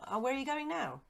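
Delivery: high, loud, eager servant's voice; eager old servant. Acted. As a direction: pitch up as the startled, fond old retainer. Servant William's voice.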